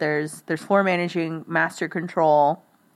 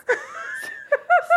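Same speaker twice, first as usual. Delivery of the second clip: put-on a sudden high yelp like a startled kid. Imitating her dad's odd noise at the shop, a sharp high yelp. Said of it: "Like a kid just yelped or something".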